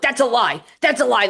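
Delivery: loud, high repeated denial; angry denial. Retold or imitated. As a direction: yell the denial twice. Abuser denying, retold.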